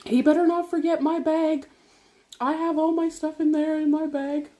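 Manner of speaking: high-pitched reenacted fussing; agitated fretting. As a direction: act out anxious griping, pitch held high. Acting out fretting in labour.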